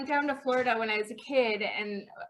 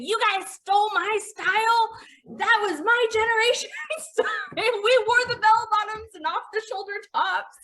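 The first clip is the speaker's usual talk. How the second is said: imitating mom; 7 semitones higher than usual. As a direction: quote mom with mock indignation. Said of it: "my mom saying".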